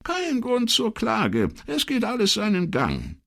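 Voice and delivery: a chatty, friendly wife on the phone; older woman's voice. Acted.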